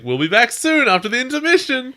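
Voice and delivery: high, bright-voiced theatrical announcement; hammed-up showman addressing the crowd; mock stage host calling out to the audience; cheery stage announcer. Villain minion addresses audience.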